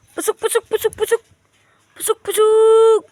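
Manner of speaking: high, loud coaxing call; calling pigeons. Real, not acted. Owner calling pigeons into the coop, high and loud.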